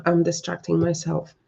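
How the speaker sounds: ordinary delivery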